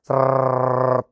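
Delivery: long rolled trill of a gush; gushing blood noise. Retold or imitated. Patient recalling blood flowing while writing songs, imitated gush. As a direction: imitate blood streaming out, drawn out. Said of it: "nah itu lagi keluar tuh".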